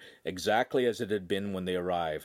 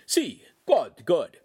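Italian master; 6 semitones higher, 7 dB louder, much slower than usual. Acted. Master praising.

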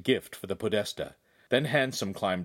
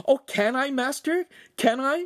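much higher, pleading and eager; excited begging; 5 dB louder than usual. A narrator's reading. Shamira begs the master for a new dress, eager and pleading. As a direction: beg like a thrilled girl. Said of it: "Shamira begged".

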